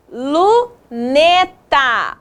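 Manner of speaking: slow, split after the first syllable. Shown as a demonstration. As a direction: break the word up for young learners. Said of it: "separamos essas palavras em sílabas".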